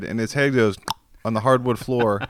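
normal talking voice

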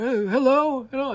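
high, bright-voiced old gentleman greeting; Harryhausen impression. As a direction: greet like a delighted elderly Englishman. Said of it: "and that's Harryhausen".

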